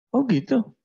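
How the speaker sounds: a startled father learning the truth; father realising his mistake, high surprise; surprised father. Acted.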